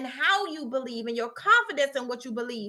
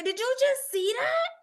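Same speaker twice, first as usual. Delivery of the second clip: mock astonished; 10 semitones higher than usual. Texting friend about shocking moment.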